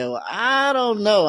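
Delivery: drawn-out, high, doubtful "well"; smiling hesitation. Retold or imitated. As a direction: stall with a smile, stretching it out high. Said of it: "I smile and I say".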